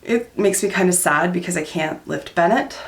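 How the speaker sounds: usual speaking voice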